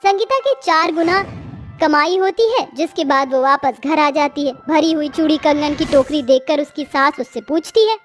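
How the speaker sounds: ordinary delivery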